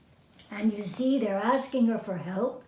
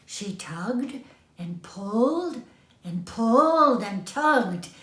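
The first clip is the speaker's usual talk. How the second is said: usual speaking voice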